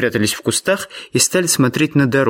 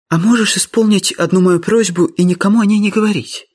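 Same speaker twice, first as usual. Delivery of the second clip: put-on higher-pitched young lady's voice, asking a favour; pleading Miss Sophia; 7 semitones higher than usual. Miss Sophia asks George to keep a secret, pleading high voice. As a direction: voice an anxious girl in a high register.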